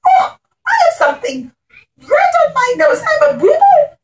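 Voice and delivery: put-on very high, childlike whimper of a boo-boo; whiny high king. The king wails to the children about his red nose, very high. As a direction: squeak it out like a hurt child. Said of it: "he says".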